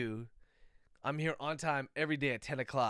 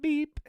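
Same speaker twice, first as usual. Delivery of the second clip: robot beep; 13 semitones higher, 6 dB louder than usual. Pretend. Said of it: "I turned into a robot".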